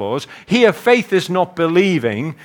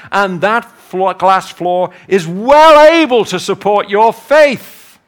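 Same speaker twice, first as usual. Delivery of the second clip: loud, raised pitch, driving emphasis; impassioned preaching; 5 semitones higher, 6 dB louder than usual. Real, not acted. Preacher urging congregation to step out in faith, loud and emphatic. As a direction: preach it with full conviction. Preacher exhorting with rising force.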